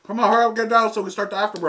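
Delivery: fast, loud, pained hurry; frantic urging. Real, not acted. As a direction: rush it out in pain. Urging partner in pain.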